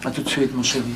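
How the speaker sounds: soft, low parting word; tender goodbye. Acted. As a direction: murmur a sweet see-you-soon. Au revoir tendre avant de sortir. (au revoir tendre à mi-voix)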